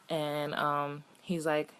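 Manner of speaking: ordinary talk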